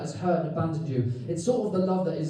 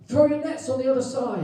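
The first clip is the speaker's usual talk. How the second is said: calling out; 6 semitones higher than usual. Retold or imitated. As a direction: call it out from the shore. Jesus calls from the shore.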